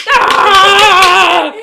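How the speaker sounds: high, very loud battle cry; fighting shout. Acted. Narrator crying out mid sword fight, loud shout. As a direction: yell as you swing.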